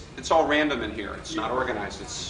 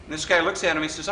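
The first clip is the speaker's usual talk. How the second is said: ordinary talk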